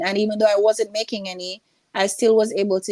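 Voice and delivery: ordinary talk, nothing marked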